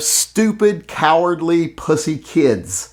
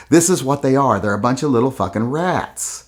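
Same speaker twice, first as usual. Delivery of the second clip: usual speaking voice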